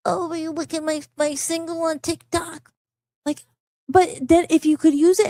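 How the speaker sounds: mocking plea. Mocking artists who beg for plays, high whiny plea. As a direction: sneer at the needy self-promoter by whining it.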